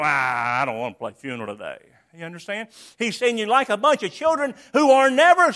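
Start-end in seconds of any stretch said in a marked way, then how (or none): 0.0-1.8 low, soft and fast sulky mumble; preacher voicing a child refusing again; sulky refusal. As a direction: brush it off like a pouting kid. Children's game quarrel acted out.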